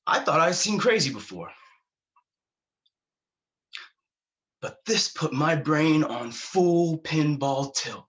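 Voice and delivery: high-pitched, agitated rush of astonishment; wound-up and incredulous; worked-up storytelling. Retold or imitated. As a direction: tell it wide-eyed, pitch leaping up. Height of a frantic war story.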